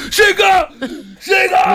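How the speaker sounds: high-pitched mock soldier's wake-up shout; gritando. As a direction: yell it up high, like rousing a soldier. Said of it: "Μη φωνάζεις".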